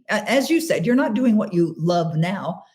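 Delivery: usual speaking voice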